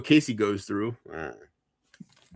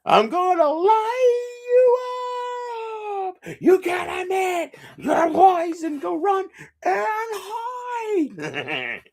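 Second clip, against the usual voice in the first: long, drawn-out high yell; high shouted threat; slower than usual. Acted. Streamer bellows a threat at a liar, drawn-out high shout. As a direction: stretch the words into a screaming taunt.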